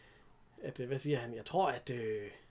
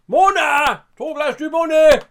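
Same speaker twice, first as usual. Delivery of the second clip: high, loud call across the bar; retold shout; 11 semitones higher, 14 dB louder, much slower than usual. Retelling how he calls to Mona for drinks, shouting. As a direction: bellow the order at the barmaid. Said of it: "Og så råber han lige ud".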